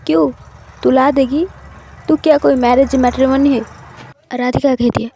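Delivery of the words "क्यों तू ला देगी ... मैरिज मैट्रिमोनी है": louder, sharp and fast at the end; a glaring friend snapping back, louder and quick; glaring retort. A narrator's reading. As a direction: snap back at her nosy friend. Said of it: "थोड़ा घूरते हुए कहती है".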